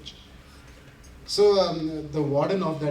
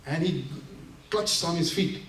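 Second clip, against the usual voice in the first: ordinary talk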